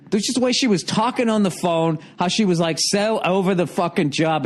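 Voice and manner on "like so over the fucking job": usual speaking voice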